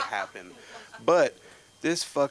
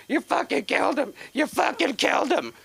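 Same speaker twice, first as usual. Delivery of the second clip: high, loud panicked yell; panicked brother; 9 dB louder than usual. Retold or imitated. Little brother screaming at him after the roof jump, high panicked yell. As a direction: shriek it like a terrified kid brother.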